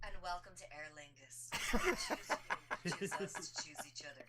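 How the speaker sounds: airline welcome announcement, hammed up; sweet gracious flight attendant greeting; air hostess voice. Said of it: "Air hostess voice".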